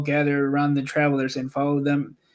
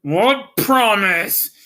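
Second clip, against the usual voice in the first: slow, louder wizard voice; Oz asking; 5 semitones higher, 4 dB louder, much slower than usual. A narrator's reading. The wizard pretending he forgot his promise, slow. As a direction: drag it out, feigning ignorance. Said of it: "the voice of Oz".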